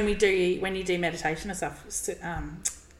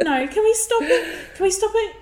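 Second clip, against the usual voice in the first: put-on kid voice; 13 semitones higher, 5 dB louder than usual. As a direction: beg like a child in the back seat. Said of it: "She's like".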